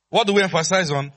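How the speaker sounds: loud, high question fired at the crowd; insistent call-and-response. Real, not acted. Preacher drilling the church on training, loud insistent prompt. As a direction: demand the answer from the congregation.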